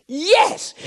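a high burst of joy, the daughter's yes; excited yes. Retold or imitated. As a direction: squeal the yes, thrilled. Daughter gets permission.

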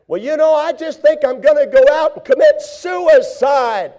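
high, loud, hopeless wail; hammed-up despair; despairing shout. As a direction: voice a person at the end of their rope. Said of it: "Wrong answer".

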